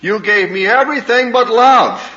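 high and loud, the hurt bursting out; bitter outcry. Retold or imitated. Daughter confronting her mother, high and loud. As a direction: fling the accusation at her.

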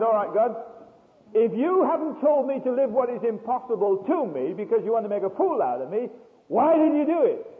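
loud, demanding question to God; loud challenge. Retold or imitated. Challenging God after joke.

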